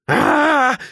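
high, loud shriek of fright; startled cry. Acted. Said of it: "Rowan zuckte erschrocken zusammen". (erschrockener, hoher Aufschrei)

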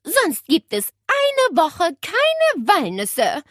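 high, loud, slow scolding; imperious warning, word by word; stern threat. A narrator's reading. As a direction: lay down the law to a naughty bird. Aunt threatens the noisy parrot.

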